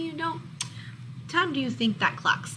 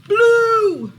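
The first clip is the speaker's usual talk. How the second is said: a sudden, much higher and louder cry; blurted shout; 11 semitones higher, 15 dB louder than usual. Acted. Monster blurting the answer in class, sudden loud shout. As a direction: blurt it out before anyone else can. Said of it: "A monster blurts out".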